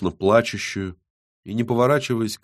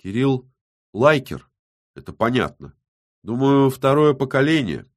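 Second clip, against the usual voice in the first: halting answer with pauses; mildly reluctant admission; feigned reluctance; 5 semitones higher than usual. A narrator's reading. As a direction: let it out piece by piece, as if unwilling. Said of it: "якобы неохотно ответила".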